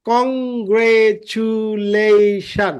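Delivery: "Congratulation" very slowly in five drawn-out syllables. Shown as a demonstration. Teacher shows five syllables, "congratulation" stretched out one by one. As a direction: hold each syllable of "congratulation" long. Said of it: "পাঁচটা syllable".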